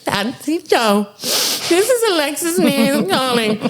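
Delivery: squeaky, drawn-out Alexis impression; high put-on voice, pushing toward hysteria; imitating Alexis. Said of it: "she's getting more and more hysterical".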